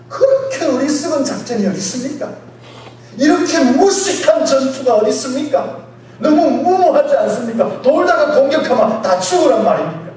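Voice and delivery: loud, high, incredulous objections; acted indignant protest. Doubters challenging Joshua's battle plan, acted indignant protest. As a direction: hammer out each doubting question like an outraged objector. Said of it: "이렇게 말하지 않겠어요".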